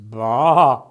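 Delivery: Mister Figgin bleating, very displeased. Acted. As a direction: bleat your disgust. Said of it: "said Mister Figgin, very displeased".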